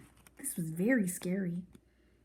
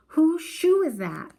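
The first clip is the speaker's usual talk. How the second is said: higher, louder, slow demand; little sister demanding to know whose shoe it is; angry demand; 8 semitones higher, 7 dB louder, slower than usual. A narrator's reading. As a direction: insist on an answer. Joey throws the shoe.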